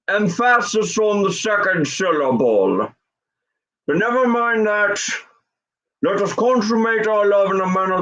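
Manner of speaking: mannered, formal impression of an old film director; Alfred Hitchcock. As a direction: deliver the seduction with stately, deliberate formality. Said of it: "talk to women like Alfred Hitchcock".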